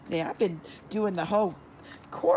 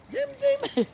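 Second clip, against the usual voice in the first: high sing-song zoom; cheering; 4 dB louder than usual. Real, not acted. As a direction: squeal it up high. Cheering running puppies.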